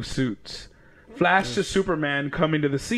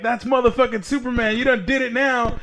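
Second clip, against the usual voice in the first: high-pitched mock heckling from behind; trash-talking taunt; 9 semitones higher, 3 dB louder than usual. Girl in the back backing up Superman, high taunting. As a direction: crow it in a much higher voice. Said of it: "she's talking shit".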